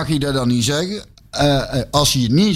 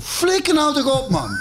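exasperated outburst, pitched up; angry; 9 semitones higher than usual. Real, not acted. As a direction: blurt it out in disgust. Fed up with PSV fans.

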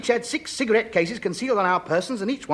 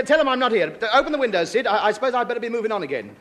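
ordinary talk

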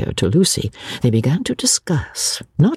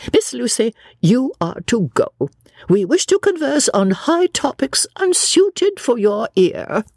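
high, grandly dismissive lady; high-pitched, self-important dismissal; bossy Miss Lavish; 10 semitones higher than usual. A narrator's reading. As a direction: send the girl away with lofty authority.

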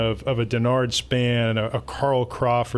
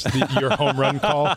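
laughing. Real, not acted. Teasing about the home-run call.

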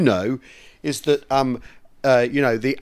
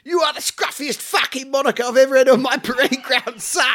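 high, strained parade-ground bawl; mock sergeant major dressing down a cadet; hammed-up drill-sergeant impression, pitched way up; Jack Lord; 11 semitones higher than usual. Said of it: "with Lord going".

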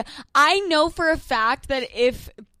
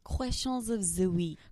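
deep announcer voice; 5 semitones lower, 9 dB quieter than usual. Acted. Low announcer take.

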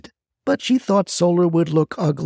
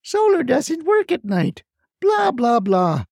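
mocking; 6 semitones higher than usual. Mocking neighbors' excuses.

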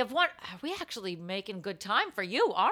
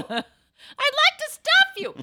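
calling out; 6 dB louder, much slower than usual. Pretend. Mock lewd call.

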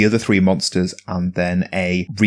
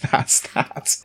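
laughing; 5 semitones higher, 4 dB quieter than usual. Real, not acted. Laughing echo of partner.

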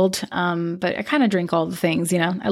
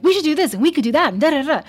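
fast, much higher, gushing imitation; bubbly excitement; 8 semitones higher, much faster than usual. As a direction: rattle off ideas like an eager co-host. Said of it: "your bubbly energy, just so excited".

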